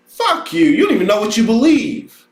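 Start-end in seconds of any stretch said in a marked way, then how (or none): 0.1-0.6 high squeaky curse. Acted. Repeated curse, high take.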